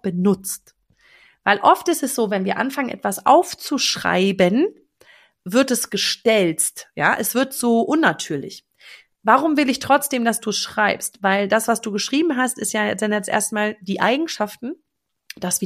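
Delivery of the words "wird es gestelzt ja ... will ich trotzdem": ordinary delivery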